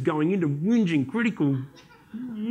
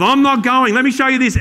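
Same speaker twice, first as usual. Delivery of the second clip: higher and louder mock refusal; loud joking refusal; 6 semitones higher, 10 dB louder than usual. Real, not acted. Speaker jokes to audience he won't visit this dentist, loud. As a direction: blurt it out as a punchline.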